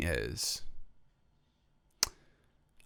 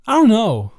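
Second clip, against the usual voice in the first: mock outburst; 12 semitones higher, 12 dB louder than usual. Joking denial.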